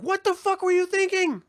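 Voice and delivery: high, mock-outraged yell from the street; mock passerby heckle. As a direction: heckle them as they walk past. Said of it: "it's just gonna be like".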